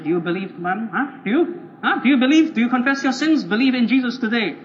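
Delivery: badgering rapid-fire demands to believe; pushy evangelist. Overzealous evangelist grabs a guy with the Bible, pushy badgering. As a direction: press him hard, question after question.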